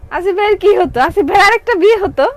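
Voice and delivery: loud, high teasing punchline; teasing joke. Real, not acted. Seller teasing her viewers about Asif bhai, loud and high teasing. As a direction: tease Asif bhai with a big playful reveal.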